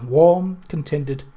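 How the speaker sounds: ordinary delivery, nothing marked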